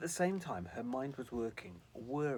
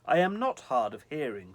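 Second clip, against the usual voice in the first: louder indignant exclaim; 2 semitones higher, 13 dB louder than usual. Acted. Sylvie exclaiming indignantly at the angel. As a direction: insist you are not deaf. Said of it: "Sylvie exclaimed".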